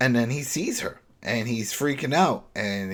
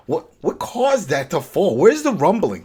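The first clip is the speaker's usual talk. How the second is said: louder and higher, really puzzled; baffled, rising emphasis; 7 semitones higher, 9 dB louder than usual. Real, not acted. Host to cohost, baffled about the collapse, louder and higher. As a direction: push the questions out in disbelief.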